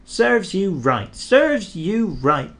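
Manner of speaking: a touch of crossness at the trucks; cross. A narrator's reading. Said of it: "puffed Thomas crossly".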